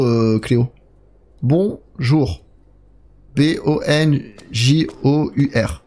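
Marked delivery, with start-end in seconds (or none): 3.4-5.8 B O N J O U R spelled out slowly, one letter at a time. Shown as a demonstration. Streamer spelling out a chat greeting, slow letter by letter. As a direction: read each letter of Bonjour separately, slowly. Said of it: "Point d'exclamation".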